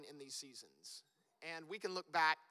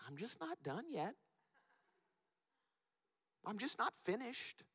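voicing God; 3 semitones higher than usual. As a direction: answer the panicking child gently. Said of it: "he's sitting there going".

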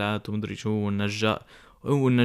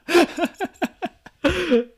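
high laugh; 5 dB louder than usual. Real, not acted. Laughing at the sad wolf picture.

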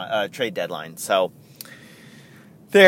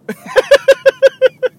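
laughing; 9 dB louder than usual. Real, not acted.

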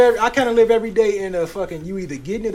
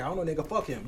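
dismissive attitude; 7 dB quieter, faster than usual. Retold or imitated. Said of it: "having an attitude like".

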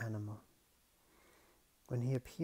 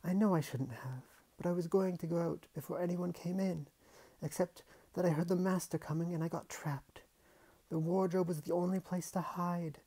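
put-on anxious girl; 6 semitones higher than usual. Girl explaining fast.

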